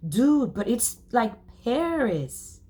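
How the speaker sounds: high, slow, dopey awe; like an idiot. Acted. Boy gushing to rich friend, slow dopey awe. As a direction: gush about Paris like a dope. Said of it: "I said like an idiot".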